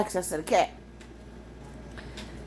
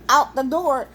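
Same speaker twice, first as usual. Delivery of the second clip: normal talking voice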